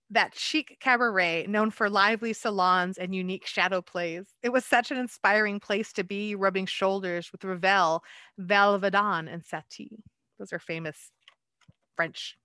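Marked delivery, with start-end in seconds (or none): 0.1-10.0 lively picture-book read-aloud; story narration. A narrator's reading. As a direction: read it like a bedtime rhyme to children.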